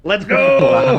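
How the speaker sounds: high, loud celebratory yell; excited cheer. Real, not acted. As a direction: hype up the table.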